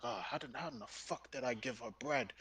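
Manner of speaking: low, very soft self-reproach; muttered dismay. Retold or imitated. Imitating the chef realising his own mistake, muttered dismay. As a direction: grumble under your breath in mock dismay.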